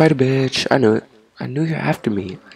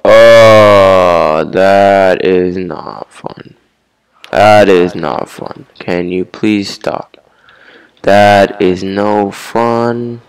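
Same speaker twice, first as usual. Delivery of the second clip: slow whiny complaint; 2 semitones lower, 6 dB louder, much slower than usual. Real, not acted. Getting hurt by zombies.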